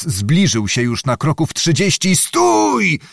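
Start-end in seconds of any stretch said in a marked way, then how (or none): 2.2-3.0 high, drawn-out challenge; booming shout. A narrator's reading. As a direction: call out "halt" high and sharp. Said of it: "huknął pan Zagłoba".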